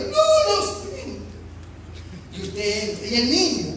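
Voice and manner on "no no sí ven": put-on scared child whimper. Child and parent in the dark.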